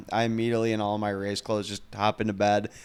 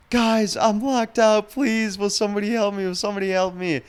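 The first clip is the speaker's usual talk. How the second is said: mock scream crying; 11 semitones higher, 6 dB louder than usual. Locked-out neighbor begging strangers to let her in, mock scream crying. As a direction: wail it like a locked-out girl begging the building. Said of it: "she starts, like, scream crying".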